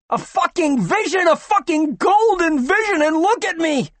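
man screaming hysterically in a flooded ward. Acted. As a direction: scream it like you've lost your mind. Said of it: "screamed the sandy-haired man".

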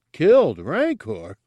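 a pig guard in disbelief; shocked grunt. Acted. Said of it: "grunted in shock".

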